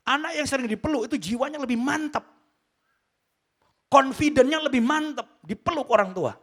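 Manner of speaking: loud, high, forceful sermon delivery; emphatic preaching. Real, not acted. Preacher urging parents to hug children, forceful preaching. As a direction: drive the point home to the congregation.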